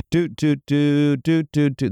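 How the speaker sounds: high playful doot-doot tune; happy friend music. Pretend. Host marking a happy ending, playful doots. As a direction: hum a cheery little jingle. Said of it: "That's our happy friend music".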